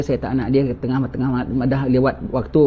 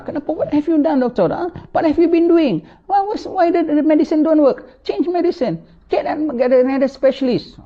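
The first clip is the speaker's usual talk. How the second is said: high, demanding rant at the doctor; angry patient; 14 semitones higher than usual. Acted. Dying patient berating the doctor, high and demanding. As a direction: scold the doctor, pitched high.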